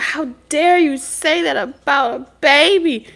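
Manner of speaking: high, slow, indignant mother; outraged mother. Retold or imitated. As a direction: scold like a shocked mom. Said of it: "She be like".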